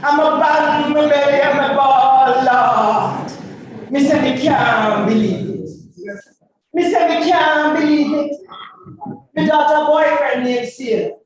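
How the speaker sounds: rhythmic patois recitation; dub poem. Acted. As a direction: chant it to the beat.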